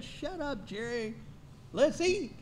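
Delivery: hungry listener begging the preacher to stop, mock whine; mock hungry congregant. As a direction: voice the impatient churchgoer. Congregant voiced in a joke.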